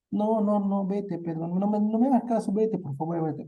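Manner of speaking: put-on high, pleading girl begging him to go; acted tearful girl. María, weeping, tells Pedro to leave him alone, high and tearful.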